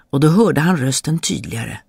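usual speaking voice

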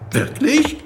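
a voiced teen girl asking in disbelief; doubting girl voice. A narrator's reading. Girl doubts the Doctor's claim, voice pitched high and rising.

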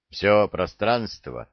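usual speaking voice

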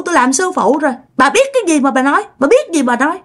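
put-on high, snapping voice of a dead girl; possessed girl's voice. Possessed girl snapping at her own mother, high. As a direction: bark it back at the mother, up high. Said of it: "Mà nạt mẹ vậy đó".

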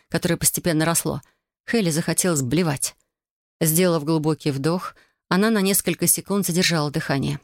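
plain reading voice

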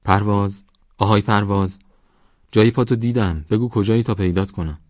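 shouting up the slope after finding tracks; loud shout. Acted. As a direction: yell his name, excited at the footprints. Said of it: "با صدای بلندتری داد زد".

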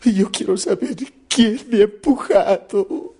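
high, loud, slow mock bawling; crying simpleton. Simpleton demanding who pushed him, hammed-up high crying. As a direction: sob out the complaint like a crybaby. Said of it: "Con lágrimas en los ojos y llorando dice".

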